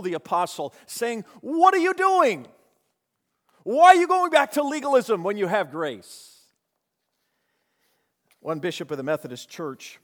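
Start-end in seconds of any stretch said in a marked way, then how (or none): 1.4-6.4 very high, loud rebuking cry; exasperated cry. Acted. Paul rebuking converts returning to legalism, very high loud cry. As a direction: shout it up high like an alarmed apostle. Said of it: "Paul had to change his tone".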